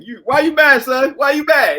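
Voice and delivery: loud, high mock taunt calling out; playful teasing yell at a grumpy cohost; mock slang taunt. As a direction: razz him with a put-on street shout. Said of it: "Is that what they say?".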